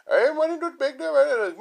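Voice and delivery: high, slow put-on caller voice; mock telemarketer. Comedian mocking a telemarketer caller, high and slow. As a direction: ham up the cold caller's greeting. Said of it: "they'll come on and just be like".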